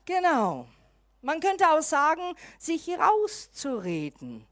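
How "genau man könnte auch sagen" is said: ordinary delivery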